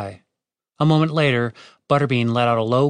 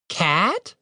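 put-on surprised child voice; 6 semitones higher than usual. Child figure recognizes her.

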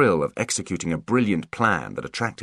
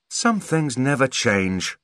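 slow amused; 6 semitones higher, slower than usual. Acted. Holly's amused parting remark.

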